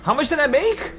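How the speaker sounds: very high, excited question; real giddy, high-pitched astonishment; thrilled disbelief. Real, not acted. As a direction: squeal the question in amazement. Amazed at own profit.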